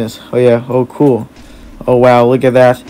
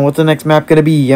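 normal talking voice

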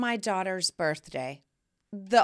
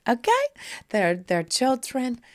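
normal talking voice

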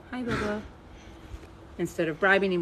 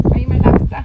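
louder, teasing call of lobster; playful loud call; 2 semitones higher, 12 dB louder than usual. Pretend. Teasing her horse with a loud, playful call. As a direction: sing out the joke at the horse.

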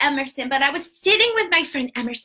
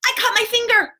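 higher, louder sudden cry; mock shock; 7 semitones higher, 6 dB louder than usual. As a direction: act out the sudden ouch. Reenacting the cut.

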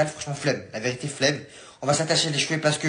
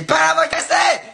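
much higher, louder and slow, "voix" yelled; frustrated shout; 12 semitones higher, 6 dB louder, slower than usual. Real, not acted. Youtuber finding his voice is not broken, exasperated shout. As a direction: yell it out in disbelief.

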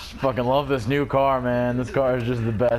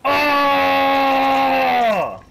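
startled cry; 10 semitones higher, 4 dB louder than usual. Real, not acted. Crying out at a mishap.

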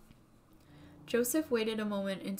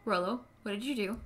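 ordinary delivery

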